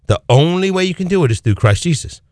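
ordinary talk

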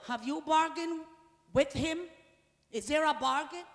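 usual speaking voice

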